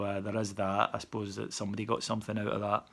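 usual speaking voice

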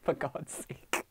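slow, soft, whispered groan; exasperated whisper. Retold or imitated. Fan reacting to the Doctor, slow whispered exasperation. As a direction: sigh it out under your breath. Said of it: "I'm like".

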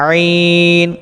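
আইন from mid-throat, long held ending; mid-throat sound. Shown as a demonstration. Teacher showing where the letter comes from, throaty আইন. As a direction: squeeze আইন from the throat and stretch it. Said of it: "কণ্ঠনালীর মধ্যভাগ হতে".

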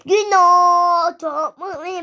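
loud, high, drawn-out mock wail; play-acted high wail; hammed-up outburst. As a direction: stretch it out in an overblown cry. Exaggerated outburst in pretend play.

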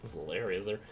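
talking through hiccups; words broken by hiccups. Real, not acted. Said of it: "Oh no, not the hiccups again".

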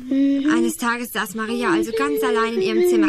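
narrating insistently over a disturbance. Acted. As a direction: push the story on over the noise. Sei doch ruhig.

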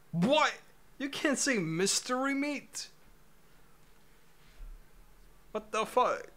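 high, loud shocked outburst; outraged disbelief. Real, not acted. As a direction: yell it in stunned disbelief. Outraged the game refuses his input.

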